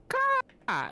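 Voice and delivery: high squawking bird cry; raven caw. Pretend. Raven call in play.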